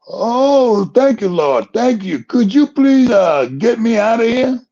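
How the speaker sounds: high, loud, relieved begging; frantic relief. Retold or imitated. Man on the cliff thanking God, high loud frantic relief. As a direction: gush thanks then beg to be saved.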